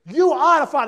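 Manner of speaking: mock reveal. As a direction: call it like the host. Paternity reveal again.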